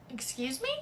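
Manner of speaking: ordinary delivery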